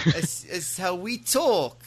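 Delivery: slow, high mimicked British accent; bad British accent. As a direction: mimic a Brit badly, stretching it out. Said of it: "No, that's bad".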